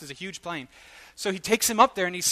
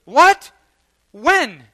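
shocked disbelief; 8 semitones higher, 12 dB louder than usual. Retold or imitated. Man told to tell his wife.

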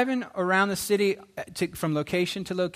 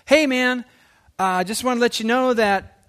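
loud, higher-pitched phone-message hello; acted voicemail greeting; 5 semitones higher, 6 dB louder than usual. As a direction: call it brightly into a voicemail.

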